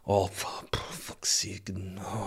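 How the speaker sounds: whispered exasperated swearing; hushed cursing. Retold or imitated. Dad whose wife has just woken up, cursing in a whisper. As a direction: curse under your breath. Muttered reaction when wife wakes.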